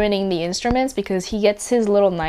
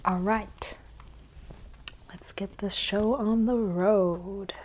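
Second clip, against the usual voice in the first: soft unvoiced whisper; 6 dB quieter than usual. Real, not acted. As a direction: whisper it, slow and hushed. Whispering start of recording.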